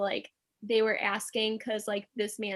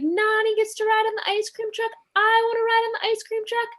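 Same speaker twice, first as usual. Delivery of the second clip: put-on high, loud childish whine of a little brother; whiny yelling little brother; 12 semitones higher, 4 dB louder than usual. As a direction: wail it like a kid denied ice cream. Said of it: "but he's like".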